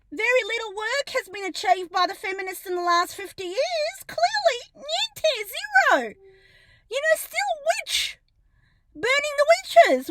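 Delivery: mock the idea with high scorn; high sarcastic scoff; bitter sarcasm. Real, not acted. Sarcastic jab about feminism.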